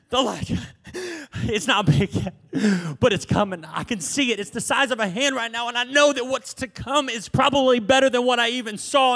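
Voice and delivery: eager, building servant report; excited servant. Retold or imitated. Servant running back to Elijah, excited. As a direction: tell Elijah the good news, rising. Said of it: "he ran back and he said".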